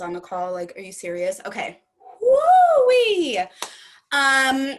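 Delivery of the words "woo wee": high, loud, long "woo-wee" whoop. Real, not acted. Emotional presenter gathers herself before talking sales, loud whoop. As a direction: let out a big high whoop to shake off emotion.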